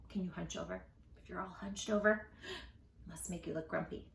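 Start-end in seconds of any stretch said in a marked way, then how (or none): none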